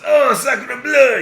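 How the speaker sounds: high, slow French cook exclaiming; shocked French cook cry out; french chef. Acted. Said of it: "Levesque, the cook, goes".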